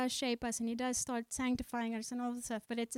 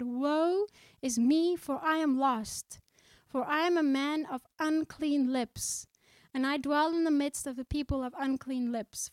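slow, louder, heavy words of lament; solemn scripture reading; 4 dB louder than usual. Acted. Preacher reads Isaiah's lament to congregation, slow and solemn. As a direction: read the prophet's cry slowly with weight.

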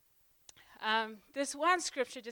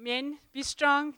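usual speaking voice

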